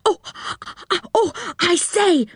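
high, excited stammering exclamation. Acted. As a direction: burst in excitedly, pitched high and breaking off. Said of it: "suddenly got very excited".

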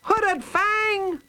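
very high megaphone-style call. A narrator's reading. Shapiro hailing her enemy through a megaphone, calling out. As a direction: call out high across a distance. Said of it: "she called out".

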